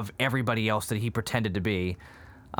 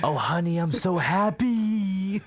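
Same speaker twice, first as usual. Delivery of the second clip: high, slow gushing delight; gushing Frank impression; 8 semitones higher, 3 dB louder, slower than usual. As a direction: coo it out like a thrilled queen. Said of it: "He goes".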